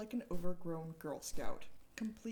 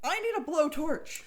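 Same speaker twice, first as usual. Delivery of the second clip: loud, high demanding boss; 7 semitones higher, 7 dB louder than usual. Acted. Eccentric boss demanding a blowtorch from his receptionist, loud. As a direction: demand it over the intercom, loudly. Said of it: "Tyrell demanding over intercom".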